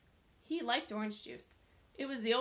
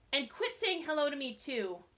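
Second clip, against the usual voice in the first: angry; 5 semitones higher, 5 dB louder than usual. A narrator's reading. Jeff angry.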